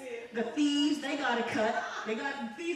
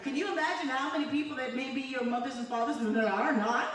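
usual speaking voice

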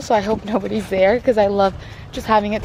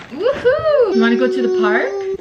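excited cheer; 9 semitones higher, 3 dB louder than usual. Real, not acted. Mom cheers her toddler toward the park, excited. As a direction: cheer the little one on.

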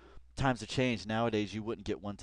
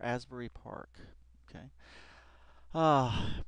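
ordinary delivery

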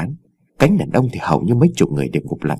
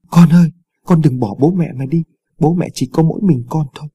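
a hint of a mother's wail, pitched up; 5 semitones higher than usual. Mother wailing for her drowned son at riverbank, mild acted wail. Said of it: "bà gào khóc".

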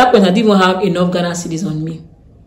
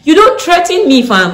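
defiant; 10 semitones higher, 6 dB louder, slower than usual. Real, not acted. Defiant at the officer.

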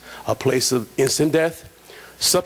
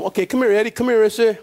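calling out; 7 semitones higher, 3 dB louder, faster than usual. Retold or imitated. Calling a fellow inmate over.

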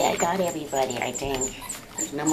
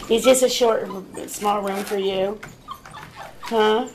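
raised, louder teasing puppy talk; 5 semitones higher, 3 dB louder than usual. Real, not acted. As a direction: coo at the pups like they're toddlers. Owner teasing puppies in pen.

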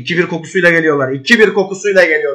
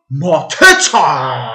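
slow stretched name call; drawn-out cheer; 3 dB louder, much slower than usual. Real, not acted. Manager watching Mateta shoot, slow stretched-out cry. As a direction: hold the name out in anticipation. Shot by striker.

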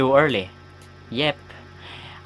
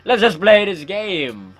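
higher, drawn-out call to get going; eager to start; 6 semitones higher, 4 dB louder, slower than usual. Real, not acted. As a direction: stretch it out, raised and eager. Impatient to start the game.

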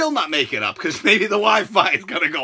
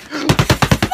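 sharp shocked gasp; 4 dB louder than usual. Acted. Reacts to cancellation.

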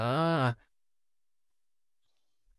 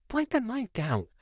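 ordinary talk, nothing marked

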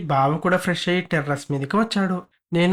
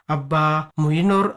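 ordinary delivery

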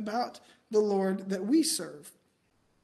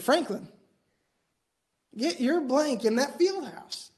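imitating an annoyed coach, higher and drawn out; fired-up coach; 5 semitones higher, 3 dB louder than usual. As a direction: call out a slow, raised-pitch order as the coach. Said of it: "getting pretty fired up".

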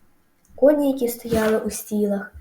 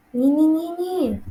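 high, drawn-out goose cry; shouted warning; 7 semitones higher, 4 dB louder, slower than usual. A narrator's reading. Goose stopping Wilbur, shouted warning. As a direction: stretch a high, alarmed cry across the repeats. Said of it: "закричала гуска".

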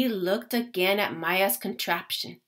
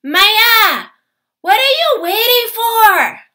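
much higher, louder shout over engines; 10 semitones higher, 11 dB louder than usual. A narrator's reading. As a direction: yell over the roar of the crowd. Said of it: "Raphael shouted over the roar".

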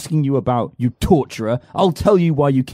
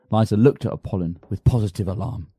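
low, soft narrating voice; story narration; 5 semitones lower, 5 dB quieter than usual. A narrator's reading. Narrator describing the silent tea, low story narration. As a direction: narrate it quietly.

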